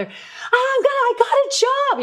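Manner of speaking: very high, loud, stretched-out squeal; so excited. Retold or imitated. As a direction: reenact shrieking big news to a brother. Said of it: "I was so excited".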